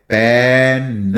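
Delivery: पेन said very slowly, vowel drawn out; slow motion word. Shown as a demonstration. Teacher demonstrating पेन in slow motion. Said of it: "slow motion में थोड़ा सा करो".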